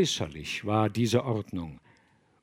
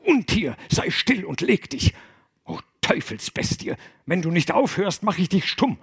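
higher, scolding threats at a dog; exasperated command, voice raised; angry calling out; 7 semitones higher than usual. A narrator's reading. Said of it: "rief Willigis".

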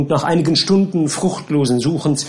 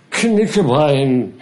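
slow drowsy mumble; sleepy murmur; 3 dB louder, much slower than usual. Acted. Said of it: "murmelte der Unsterbliche aus dem Schlaf". (schläfrig gemurmelt)

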